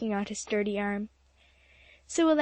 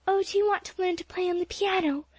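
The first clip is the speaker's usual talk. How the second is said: breathless; 8 semitones higher than usual. A narrator's reading. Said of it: "asked Polly breathlessly".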